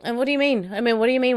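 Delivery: replaying her own exasperated outburst; fuming. On the phone to her dad, exasperated. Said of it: "I was fuming".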